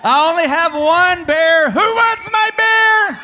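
high, drawn-out crowd-rousing shout; hyped-up shout. Real, not acted. Preacher hyping kids to win a bear, shouting. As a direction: holler it slow and high to the crowd.

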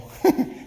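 a quick, very high breathless laugh; high breathy laugh. Real, not acted. Pastor laughing at the old lady's stock list, high and breathless. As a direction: laugh at how rich she was.

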